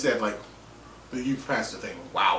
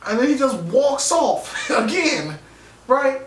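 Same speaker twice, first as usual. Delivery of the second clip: rising, louder, amazed; excited; 10 semitones higher, 3 dB louder than usual. Real, not acted. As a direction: build up the disbelief. Building amused disbelief.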